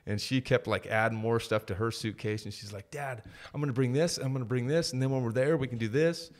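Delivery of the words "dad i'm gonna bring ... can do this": higher, louder, imitating an eager kid; excited daughter. Daughter telling Dad what she will pack, excited. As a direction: act out a child bubbling over with plans. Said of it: "my daughter was so excited".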